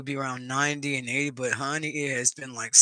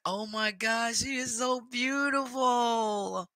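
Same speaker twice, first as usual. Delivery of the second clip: awed; 9 semitones higher, much slower than usual. Retold or imitated. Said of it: "I was like".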